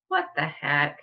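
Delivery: normal talking voice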